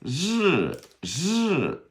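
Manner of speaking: "日" as buzzed sh turning into R, high falling; ri sound demo. Shown as a demonstration. Teacher showing sh into R, high buzzed "日". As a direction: draw out "日" high as a zh-like R, then drop. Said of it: "go to the R".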